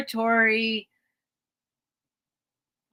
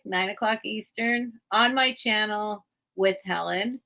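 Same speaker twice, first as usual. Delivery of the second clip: ordinary delivery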